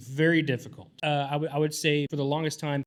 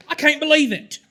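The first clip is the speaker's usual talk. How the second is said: high, loud exaggerated Southern accent; 13 semitones higher, 11 dB louder than usual. Creator showing his put-on Southern voice, loud Southern twang. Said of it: "the Southern accent".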